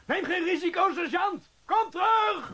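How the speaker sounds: high, loud pleading call; alarmed shout. Acted. Corporal shouting after the sergeant heading to the bomb. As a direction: yell after him to come back.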